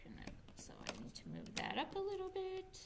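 whispered note to self; teacher whispering to herself while moving the card; whisper aside. Real, not acted. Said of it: "whispered aside".

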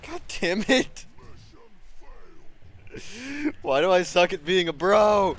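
loud, high exasperated outburst; frustrated yelling. Real, not acted. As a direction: rage at the screen. Raging at the game.

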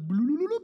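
cartoon whoosh-up noise; playful bloop. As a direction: pop it out like something vanishing upward. Jesus ascending, playful sound effect.

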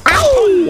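high loud ouch; squeaky cry of pain; sharp yelp. Real, not acted. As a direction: yelp at a miss. Bird shot fails.

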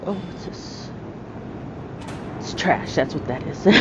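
hushed whisper to yourself; soft unvoiced muttering; whispered aside. Real, not acted. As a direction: whisper it like a private joke. Muttered aside about trash.

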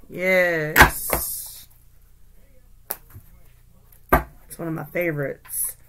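ordinary talk, nothing marked

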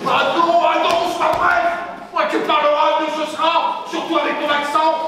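loud, high-pitched exasperation; exasperated protest. Acted. Coach stopping a bad rehearsal over the accent, exasperated. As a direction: cut in high and loud, fed up.

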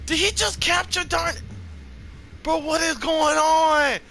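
can't believe the capture; high stunned yell; shocked. Real, not acted. Teammate captured, disbelief.

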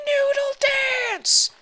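high, loud goofy shout; noodle dance shout. Pretend. Player calling out the dance move, goofy high shout. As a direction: yell it like a silly battle cry.

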